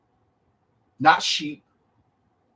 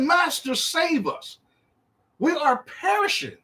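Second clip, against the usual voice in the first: much higher, mock-alarmed plea; pleading disciple; 9 semitones higher than usual. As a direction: plead for rescue in a high acted voice. Acts perishing disciples begging for rescue.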